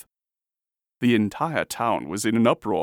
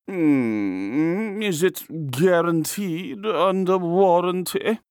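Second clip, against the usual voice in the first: slow, high, humming query; hum, then question it slowly and fussily; appraising artist; 8 semitones higher, 3 dB louder, much slower than usual. A narrator's reading. Artist examines the nose.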